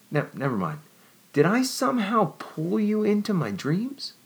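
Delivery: high, breathless wonder rising; wondering young woman. A narrator's reading. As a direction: pile up the questions in awe. Jennifer voiced.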